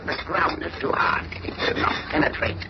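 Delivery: muttering to himself. Acted. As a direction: grumble under your breath. Alien grumbles over the hard ground.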